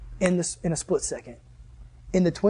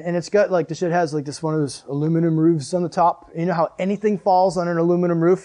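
ordinary talk

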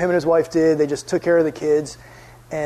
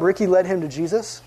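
ordinary talk

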